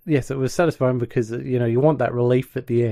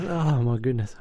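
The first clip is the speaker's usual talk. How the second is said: ordinary talk, nothing marked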